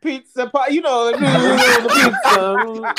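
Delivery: put-on high, slow hammy Italian accent. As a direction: ham up a cartoon Italian. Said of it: "what was your Italian accent again".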